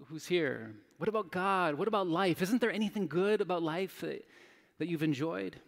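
ordinary talk

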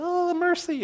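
put-on a kid giving up in pain; whiny child. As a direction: squeal it like a hurt schoolboy. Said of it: "the weak one would be like".

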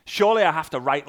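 normal talking voice

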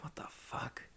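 hushed, whispered thought to oneself; whispered aside. Retold or imitated. Storyteller's private reaction before answering his acquaintance, whispered. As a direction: whisper it under the breath.

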